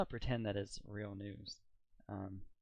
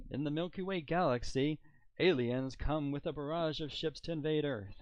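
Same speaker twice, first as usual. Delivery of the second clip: mock news anchor; 5 semitones higher, 7 dB louder than usual. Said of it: "kind of a professional news anchor type, a voice".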